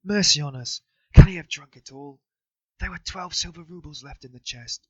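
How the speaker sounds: high, loud, desperate outburst; wife in fury over the drunk money; furious despair. A narrator's reading. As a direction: rage at the lost roubles. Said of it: "And in fury".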